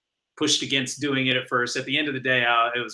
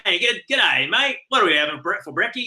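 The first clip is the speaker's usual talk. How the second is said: higher-pitched, hammed-up Australian greeting; obnoxious Australian accent; 6 semitones higher than usual. Husband teasing his wife in the morning, obnoxious Australian accent. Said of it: "when I would do my Australian accent".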